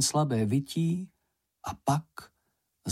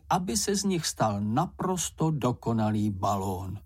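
plain reading voice

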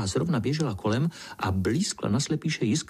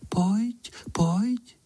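high, airy little voice, rising; high, luring little call; whispery coaxing call; 12 semitones higher than usual. A narrator's reading. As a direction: beckon in a tiny whispery voice. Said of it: "a hlásek šeptá".

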